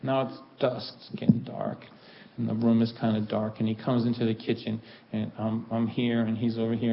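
ordinary talk, nothing marked

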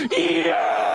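a hammed-up triumphant yes; retold scream. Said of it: "screamed at the top of my lungs".

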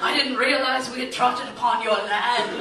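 lightly put-on subject addressing royalty; hint of mock-noble formality; mock courtly apology. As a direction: grovel to her as if she were nobility.

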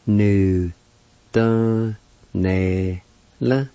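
"Nu, da, ne, la." with a pause after each syllable. Shown as a demonstration. Teacher pronouncing the word performed, "Nu, da, ne, la." syllable by syllable. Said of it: "is pronounced like this".

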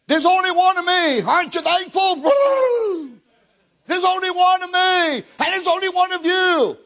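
high, ringing proclamation on one; preaching at full force. Acted. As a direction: lift it up for the congregation.

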